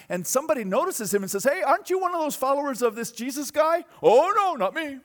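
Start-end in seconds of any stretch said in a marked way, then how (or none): 1.4-3.8 higher, louder prying question; accusing bystander. Acted. Preacher voicing a bystander who spots Peter, prying and loud. 4.0-5.0 high, loud nervous brush-off; Peter's denial. Acted. Peter by the fire denying the bystander, high and nervous. Peter denies, first time.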